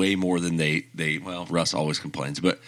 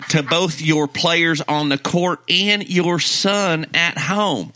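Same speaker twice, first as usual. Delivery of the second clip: mock scolding; 7 semitones higher than usual. Mock scolding Westbrook as fans.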